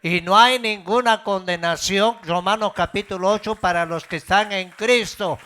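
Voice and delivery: loud, high impassioned preaching; preaching at full force. Acted. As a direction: thunder it out to the congregation.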